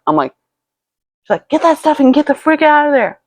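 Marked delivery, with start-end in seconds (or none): 1.5-3.2 high-pitched urgent mom voice; imitated alarmed mother. Mother on the phone ordering daughter out, high urgent imitation.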